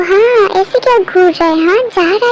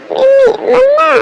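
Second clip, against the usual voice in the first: put-on crying child; 6 semitones higher than usual. Child pleading with her mother.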